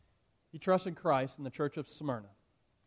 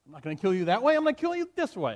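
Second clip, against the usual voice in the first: much higher and louder, furious threat; frustrated threatening shout; 9 semitones higher, 7 dB louder than usual. Acted. Frustrated proconsul threatening to burn Polycarp, shouting. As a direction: rage at the stubborn prisoner. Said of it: "he's getting frustrated".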